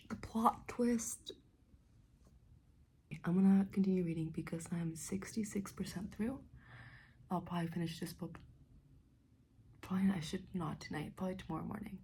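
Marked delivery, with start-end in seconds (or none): 0.1-6.4 hushed whisper, choked up. Real, not acted. Vlogger whispering to viewers late at night, moved. 9.8-11.1 hushed whisper, choked up. Real, not acted. Vlogger whispering to viewers late at night, moved.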